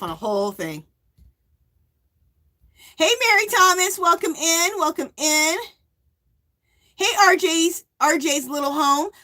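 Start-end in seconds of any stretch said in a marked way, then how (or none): 3.0-9.1 livestream greeting. Real, not acted.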